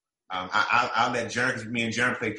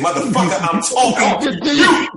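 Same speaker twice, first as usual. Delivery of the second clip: loud, drawn-out imitation of a cursing coach; mock coach's shout; coach yelling; 12 semitones higher, 9 dB louder than usual. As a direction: bellow it like the coach across the gym. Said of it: "You know what he said?".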